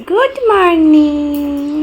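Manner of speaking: very slow, high, loud good morning; long, loud, drawn-out call; drawn-out greeting. Real, not acted. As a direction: stretch the greeting right out, loudly.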